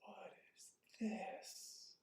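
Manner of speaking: very slow, high whispery question; hushed wondering. Shown as a demonstration. Teacher showing another way to ask, slow hushed whisper. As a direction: stretch it out softly, as if in awe. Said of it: "You know, it can be".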